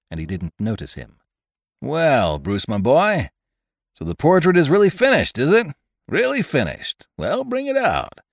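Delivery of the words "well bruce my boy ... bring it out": hearty, booming uncle with swooping pitch; high-spirited, expansive old boy; jovial goodwill. A narrator's reading. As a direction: greet them jollily, full of expectation. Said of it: "all was joy, jollity, and goodwill".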